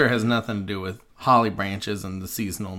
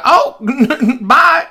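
high squeaky voice; 14 semitones higher, 11 dB louder than usual. Retold or imitated. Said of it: "and he's like".

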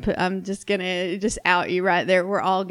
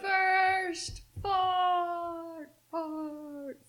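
high echo dying away; fading echo; 8 semitones higher, 7 dB quieter, much slower than usual. Pretend. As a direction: let each fart fade like an echo. Echoing title, fading away.

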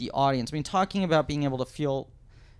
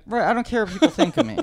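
mock not caring; 9 semitones higher, 8 dB louder, faster than usual. Voicing Newhart's attitude.

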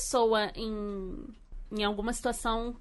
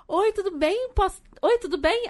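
put-on high, sugary shop-girl greeting; high, sweet clerk voice; 7 semitones higher than usual. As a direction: chirp it like an overly sweet saleswoman. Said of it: "a moça toda fofa veio falar com a gente".

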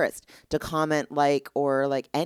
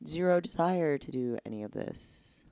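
unmotivated slump; 5 semitones lower, 5 dB quieter than usual. Retold or imitated. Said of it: "you're like".

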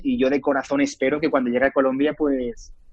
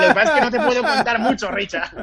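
bright, higher, teasing repeat of the word; playful excitement; 7 semitones higher, faster than usual. Real, not acted. Teasing the interviewer about his secret new series, playfully excited. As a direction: tease the host about a secret he can't share. Host asks about an exclusive.

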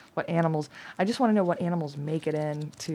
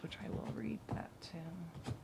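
whispered, much softer, to herself; whispered aside; 15 dB quieter than usual. Real, not acted. Reader whispering a note to herself mid-reading. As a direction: drop to a whisper as a side note.

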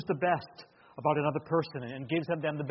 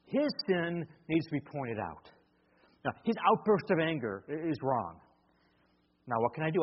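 usual speaking voice

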